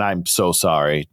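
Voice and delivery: ordinary talk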